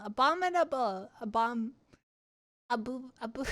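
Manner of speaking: ordinary talk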